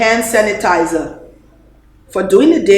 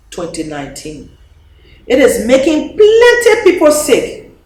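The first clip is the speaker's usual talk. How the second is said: put-on reading to children. President reading a covid picture book to children, lively storytelling. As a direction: read it brightly like a bedtime story for children.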